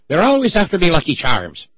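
high, loud hammy Irish lilt; mock Irish leprechaun. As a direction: do the cereal-ad leprechaun.

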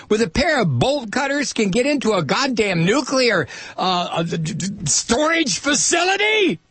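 high, rising disbelief, stammering to a shout; incredulous outburst. Real, not acted. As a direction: stammer and then explode in disbelief. Host incredulous at security failure.

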